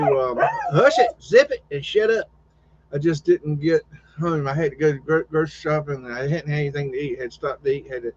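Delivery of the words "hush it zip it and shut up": sharp high hush, then shut up; snappy pet scolding; hushing the dog. Real, not acted. Scolding a noisy animal at home.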